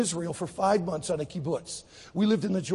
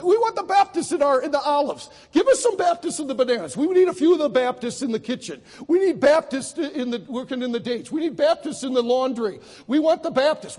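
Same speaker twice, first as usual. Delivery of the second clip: higher, louder voices competing for workers; clamoring demand; 6 semitones higher, 5 dB louder than usual. Retold or imitated. Israelis fighting over Baptist volunteers, clamoring demand. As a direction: call out urgently, like bidders fighting over the same team. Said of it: "every day they fought over us".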